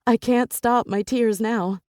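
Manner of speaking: ordinary delivery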